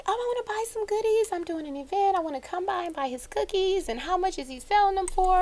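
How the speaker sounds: high, soft, quick chatter; eager customer. Retold or imitated. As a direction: retell it as a keen, high-voiced customer. Retelling eager customer's request.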